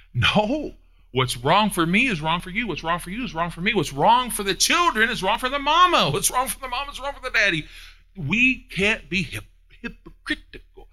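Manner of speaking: high, loud preaching cry; preaching shout. Real, not acted. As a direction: lift it up and drive it home like a revival.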